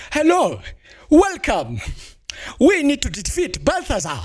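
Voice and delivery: put-on a playful jab at an African accent for a game NPC; mock African accent. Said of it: "You can't make them sound a little bit African?".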